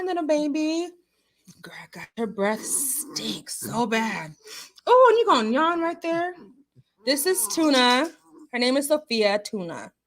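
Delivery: usual speaking voice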